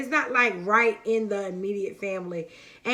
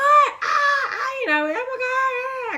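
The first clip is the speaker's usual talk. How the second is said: very high, loud delighted shrieks; excited yelling; 14 semitones higher, 5 dB louder, slower than usual. Retold or imitated. In-laws yell over twins news, high excited shrieks. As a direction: shriek like family hearing big news. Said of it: "they was yelling".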